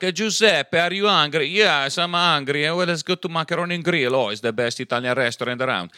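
high, loud hammed-up fake Italian accent; mock an Italian radio ad. Mocking a radio ad, a hammed-up fake Italian accent. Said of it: "I hear this commercial on the radio".

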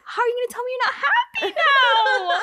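replaying the fight with a laughing cry; incredulous outburst. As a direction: throw it back at him, cracking with laughter. Retold outburst at the boyfriend.

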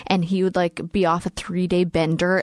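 normal talking voice

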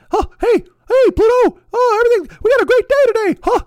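very high squeaky falsetto, loud and manic; hammed-up cartoon mascot cheer; Mickey Mouse. Mocking his own cheerful texts, squeaky cartoon glee. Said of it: "And the Mickey Mouse fucking texts".

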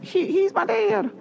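high, slow tearful confession; reenactment. Retold or imitated. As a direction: squeak it out softly, hurt. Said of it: "That was a great reenactment".

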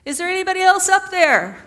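big, high, loud holler; yelling up. Acted. Man on the cliff rejects God's advice, yelling upward. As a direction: shout past the first voice for other help. Man calls up again, joke punchline.

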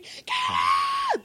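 very high, loud panicked scream; retold scream. Player reenacting screaming at the alligators, high scream. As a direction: scream it out in mock terror. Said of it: "I'm screaming".

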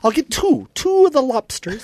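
very high comic impression; Tom Green. Tom Green ordering two lobsters, very high comic impression. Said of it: "That's exactly what he sounds like".

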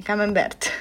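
camembert with a hard final t, the Polish way. Shown as a demonstration. Teacher showing how Poles say camembert. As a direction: Polish camembert, every letter sounded. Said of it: "po polsku".

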